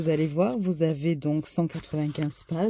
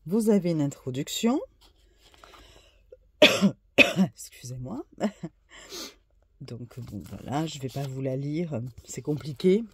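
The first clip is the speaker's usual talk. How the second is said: hoarse voice. Real, not acted. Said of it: "avec ma voix cassée et mon nez bouché".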